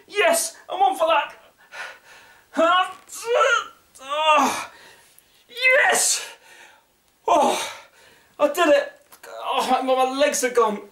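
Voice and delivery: high, breathless relief; collapsing after a race. Real, not acted. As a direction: gasp out relief, legs gone. Collapsing after finishing sit-ups.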